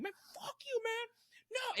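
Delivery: high, soft mock scream; imitated yelling. Angry friend cursing at someone in the alley, mock yelling. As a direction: yell it in a squeezed, hushed falsetto. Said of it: "And he's, like, yelling".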